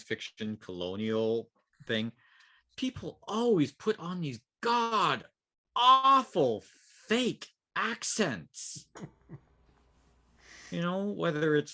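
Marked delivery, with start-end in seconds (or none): 2.8-8.9 GOD AWFUL FAKE ACCENTS, each word high and drawn out; exasperated emphasis. Real, not acted. Cohost griping about gamers' role-play, exasperated stress on AWFUL FAKE ACCENTS. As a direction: punch AWFUL high and loud, then stretch FAKE ACCENTS slowly.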